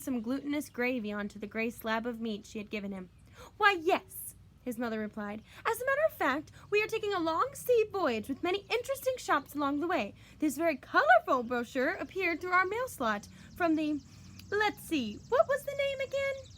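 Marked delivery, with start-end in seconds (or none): 3.6-4.4 higher, louder bright mother reply. A narrator's reading. Mother answering her son, high bright voice. 5.7-16.5 much higher, louder gushing mother. A narrator's reading. Mother showing off the travel brochure, high gushing voice.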